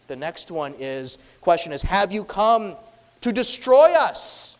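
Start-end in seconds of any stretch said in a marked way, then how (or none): 1.8-4.5 high, loud, slowed demand; defiant challenge. Acted. Demon demanding an answer from Jesus, loud defiant shout. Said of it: "though, an obstinate".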